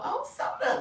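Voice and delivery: real happy surprise; surprised greeting. Real, not acted. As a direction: pop up high in surprise. Surprised to see a viewer.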